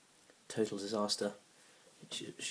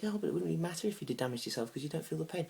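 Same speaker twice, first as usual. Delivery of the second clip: lightly voiced date, high and quick; hint of the date's quicker, higher voice; date arguing; 7 semitones higher, faster than usual. Date arguing back about pain, lightly imitated.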